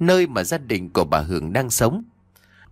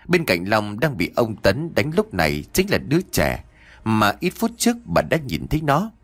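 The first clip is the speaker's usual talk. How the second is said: plain reading voice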